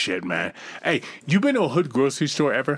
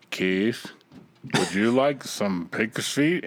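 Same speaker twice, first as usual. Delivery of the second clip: put-on lower, slow old Georgia grandfather; grandfather's voice; 6 semitones lower, 4 dB quieter, much slower than usual. Grandfather offering pig's feet to young Keith, low and slow. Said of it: "my grandfather talk".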